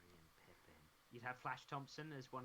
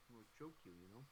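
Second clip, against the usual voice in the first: Stallone Vader; 5 semitones lower, 10 dB quieter, faster than usual. Pretend. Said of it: "Stallone as Darth Vader".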